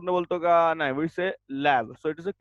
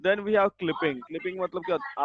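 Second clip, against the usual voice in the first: ordinary talk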